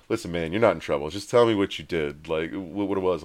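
low, soft reassurance from a paramedic; imitating a discreet paramedic; confidential coaxing. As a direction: drop the voice and coax out the truth. Paramedics chatting him up, acted.

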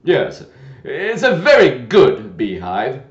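loud, a touch of discontent; disgruntled muttering. Acted. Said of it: "the knight said in a discontented tone".

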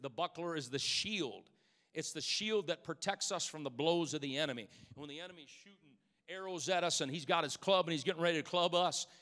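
ordinary delivery

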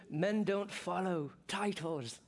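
a hammed-up Braveheart Scottish brogue; a pastor doing a bad Scottish accent for his congregation. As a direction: play William Wallace with a mock Scottish accent. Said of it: "for that horrible accent".

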